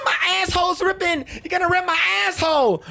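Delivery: high, fast hammed-up screaming; screaming in labor. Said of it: "this entire time she's screaming".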